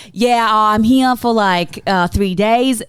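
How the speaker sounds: put-on loud, broad Aussie accent; stronger Aussie accent. Nervously answering the officer to get through, stronger Aussie accent. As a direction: lay the Aussie accent on thick. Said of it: "I make an even stronger Aussie accent".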